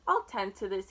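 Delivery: ordinary delivery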